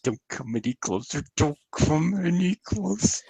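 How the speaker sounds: hushed warning, rising in pitch on the repeat; soft mock plea to keep away; mock muttered warning. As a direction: play at softly warding someone off, hammed-up. Acting out Discord wanting to be left alone.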